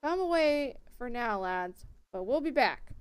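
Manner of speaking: put-on loud rallying call in a country accent. Mob leader calling off his lads, loud country accent. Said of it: "gave that guy a country accent".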